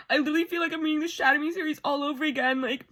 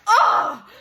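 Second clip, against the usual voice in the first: loud, high "oh"; swooning cry; 9 semitones higher, 8 dB louder than usual. Real, not acted. Reader swooning at hero's promise, loud cry.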